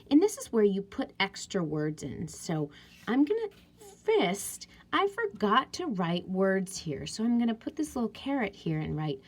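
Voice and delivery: ordinary talk, nothing marked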